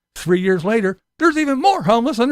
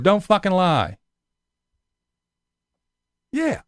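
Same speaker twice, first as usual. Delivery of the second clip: ordinary talk, nothing marked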